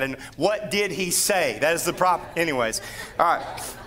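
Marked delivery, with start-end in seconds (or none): none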